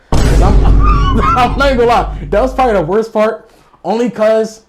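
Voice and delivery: high, loud laughing admission; recalling the worst part of the night, laughing; laughing confession. Real, not acted. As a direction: laugh while admitting it. Laughing at own embarrassment.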